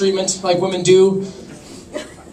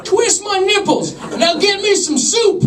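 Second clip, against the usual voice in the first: put-on much higher, slow old man's quaver; old grandpa voice; 10 semitones higher, slower than usual. Much higher and slow, a grandpa talking to his partner. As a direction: play a doddering grandpa asking for things.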